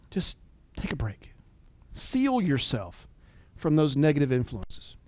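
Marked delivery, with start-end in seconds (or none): 0.1-1.3 whisper. Real, not acted. Soft plea to take a break.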